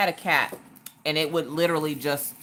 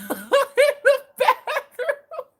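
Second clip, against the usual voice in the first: much higher, drawn-out word through laughter; laughing; 6 dB louder, much slower than usual. Real, not acted. Streamer cracking up at her judging cat, laughing through the words. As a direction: let the laugh carry the words out.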